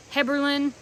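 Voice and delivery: Heberlin with a short, unstressed "lin" ending; probable correct pronunciation. Shown as a demonstration. Owner giving the fiddle maker's likely name, Heberlin ending in "lin". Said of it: "is probably how you pronounce it".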